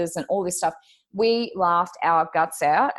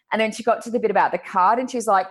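normal talking voice

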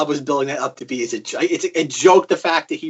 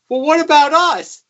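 high, loud whiny plea; pleading thumbs; 13 semitones higher, 9 dB louder than usual. Retold or imitated. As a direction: plead like a disfigured victim. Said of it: "And it's like".

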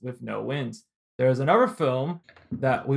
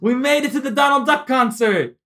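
loud high cheering; excited; 10 semitones higher, 7 dB louder than usual. Real, not acted. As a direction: celebrate it loudly. Hyping the Donald Duck Oscars.

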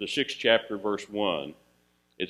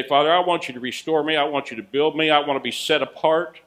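earnest prayer; 4 semitones higher, 6 dB louder than usual. Pretend. Preacher voices a prayer.

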